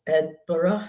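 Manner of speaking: Edinburgh shortened to "Ed-burra"; Scottish pronunciation. Retold or imitated. Imitating an Instagram Scotsman's lesson, Edinburgh as "Ed-burra". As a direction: say Edinburgh ending in a light "burra". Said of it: "how to properly pronounce".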